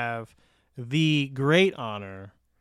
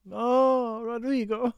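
higher-pitched, drawn-out pretend pining; mock lament; 8 semitones higher, slower than usual. Hearing the favourite actor is absent, mock lament.

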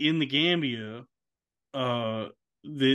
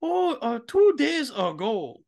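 much higher, slower mock reply from the owners; mock dog owner; 11 semitones higher, 4 dB louder, slower than usual. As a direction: play the owners sheepishly answering the vet. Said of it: "the family would be like".

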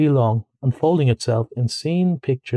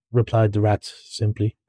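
plain reading voice

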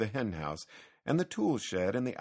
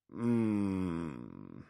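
long, falling doubtful "mm"; doubtful hum; 3 semitones lower than usual. A narrator's reading. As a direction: hum with drawn-out reluctance. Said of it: "the old man was doubtful".

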